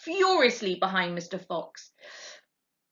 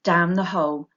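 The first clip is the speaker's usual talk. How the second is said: usual speaking voice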